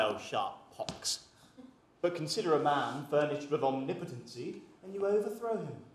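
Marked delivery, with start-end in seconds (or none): 0.0-1.2 low, slow groaning sigh; old man's low weary sigh. Retold or imitated. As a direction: sigh it like a worn-out old rake. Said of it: "should sigh".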